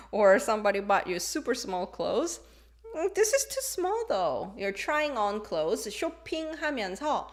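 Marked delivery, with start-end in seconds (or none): 2.9-4.5 dismayed complaint. Acted. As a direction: act out an unhappy fitting. Acting out trying on small clothes.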